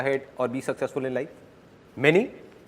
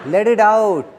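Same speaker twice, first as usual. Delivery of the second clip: urging loud; 6 semitones higher, 5 dB louder, much slower than usual. Shown as a demonstration. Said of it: "Don't suppress your laughter".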